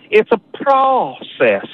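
"process" stretched slow and high; Southern drawl on process. Shown as a demonstration. Caller showing the southern "process", slow and drawn. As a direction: draw out "process" like Louisiana. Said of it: "make that O and A".

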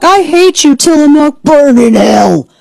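high-pitched mock fury; outraged internet mob, hammed up and drawn out at the end; mock outrage. Acting out outraged online critics.

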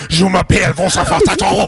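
hammed-up French accent, half sung; mock French accent. Host mocks Montreal locals in a French accent. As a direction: lilt it like a teasing Quebecer.

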